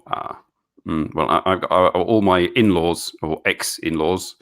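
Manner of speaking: ordinary delivery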